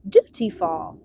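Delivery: ordinary delivery, nothing marked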